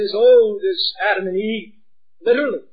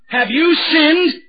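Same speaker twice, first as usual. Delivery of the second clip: higher, louder and slow, pressing hard; stern demand; 8 semitones higher, 7 dB louder, much slower than usual. Acted. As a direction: drag the question out like a judge. God's question voiced.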